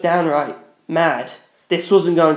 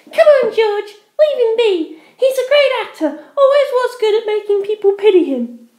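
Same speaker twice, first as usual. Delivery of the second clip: high, loud scornful snap; snapping; 4 dB louder than usual. Acted. Penelope snaps at her ex, high and scornful. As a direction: snap at him in disgust. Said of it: "Penelope snapped".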